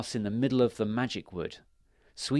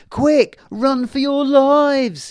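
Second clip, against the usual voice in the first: very high, very loud, slow alarm cry; panicked shout; 12 dB louder, much slower than usual. A narrator's reading. Fairy warns the wood of a Bigfoot: very high, loud shout. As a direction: yell to send everyone running. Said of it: "he shouted".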